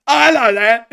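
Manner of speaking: loud, high hammed-up Cockney; over-the-top Cockney caricature; Cockney accent. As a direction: shout it like a drunk Cockney bloke. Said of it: "enjoying the Cockney accent".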